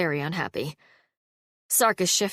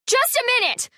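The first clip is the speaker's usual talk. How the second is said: very high, louder brisk call; 4 dB louder than usual. A narrator's reading. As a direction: call out to stall them. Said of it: "called Halla".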